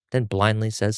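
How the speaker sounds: ordinary delivery, nothing marked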